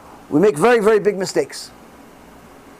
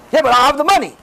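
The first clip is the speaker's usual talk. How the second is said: objecting congregant; 4 semitones higher, faster than usual. Retold or imitated. Imagined objector answering back.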